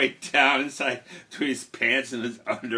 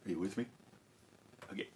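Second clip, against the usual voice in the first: usual speaking voice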